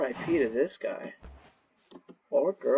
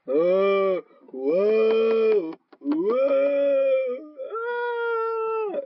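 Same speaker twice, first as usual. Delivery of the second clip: rising mock wail; 11 semitones higher, 5 dB louder, much slower than usual. Real, not acted. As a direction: voice sweeping up into a high squeal. Mic test, rising vocal sweep.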